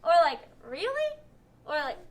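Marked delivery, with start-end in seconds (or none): 0.7-1.1 "Really" higher, rising at the end; question. Shown as a demonstration. Teacher contrasts possible meanings, higher questioning. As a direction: ask it with pitch lifted. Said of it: "no idea if it meant".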